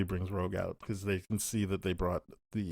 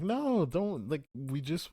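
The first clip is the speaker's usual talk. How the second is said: very high retold objection; mock a high, pleading interruption; imitated pleading protest; 8 semitones higher, slower than usual. Host voices Jubilee objecting to Rogue, high imitated protest.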